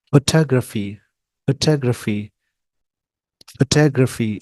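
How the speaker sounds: फोटोग्राफी as "fuh-TAH-gruh-fee", falling at the end; quick first syllable, stressed second in फोटोग्राफी; British pronunciation. Shown as a demonstration. Teacher repeating the word for learners, British pronunciation. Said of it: "और British में".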